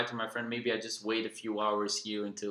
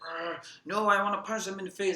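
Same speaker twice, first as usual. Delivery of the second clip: higher, fast, hammed-up grumble; mock angry growl; 7 semitones higher than usual. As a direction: play a sulky hothead growling back. Angry Lucas growls again.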